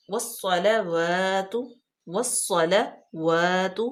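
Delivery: "والصلوات" very slow, واو rounded with the lips; drawn-out "والصلوات"; careful recitation. Shown as a demonstration. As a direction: model "والصلوات" slowly. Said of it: "واو جو ہے اس کو ہونٹوں کی گلائی سے".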